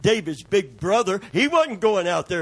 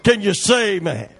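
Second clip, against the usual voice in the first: ordinary talk, nothing marked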